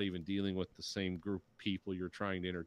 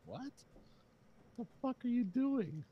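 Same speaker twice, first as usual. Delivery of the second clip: mock exasperation. Imitating exasperated viewers after long wait.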